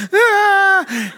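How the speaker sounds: sudden high shrieking scream, imitating the fiancée; terrified scream. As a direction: shriek as she is pushed to the door. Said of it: "She's literally saying".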